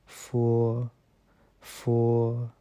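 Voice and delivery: फो said with lip-teeth f, fo; फो, the Chinese f sound. Shown as a demonstration. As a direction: let air hiss through the teeth on फो. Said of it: "इसको हम बोलेंगे".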